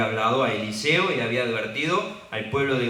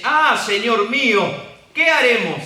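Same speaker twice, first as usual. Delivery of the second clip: desperate cry; 10 semitones higher, 10 dB louder than usual. Acted. Servant crying out to his master Eliseo, high and desperate. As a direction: cry out as if all is lost. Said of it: "se desespera y dice".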